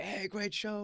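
high, breezy passing praise; cheerful departing fan. Retold or imitated. Fans leaving Fenway greeting the comic, breezy and high. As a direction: toss it off like a happy fan leaving. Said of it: "people were walking out and just went".

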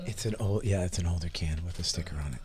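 unvoiced hushed whisper. Real, not acted. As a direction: whisper so he can't hear. Hiding from Jacob, whispering.

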